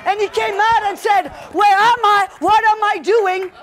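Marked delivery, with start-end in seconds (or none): 1.5-3.5 loud, acted-out confusion; bewildered outcry. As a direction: cry out as if suddenly woken, hammed-up. Raised young man wakes bewildered.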